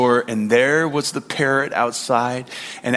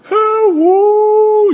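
very high, slow drawn-out dog howl of words; howling talking dog; 7 dB louder than usual. Retold or imitated. Imitating viral talking dogs, high stretched howl. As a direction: howl it like a dog trying to talk. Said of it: "they would howl in such a way".

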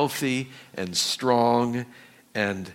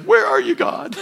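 desperate cry; 6 dB louder than usual. Retold or imitated. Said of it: "It's like".

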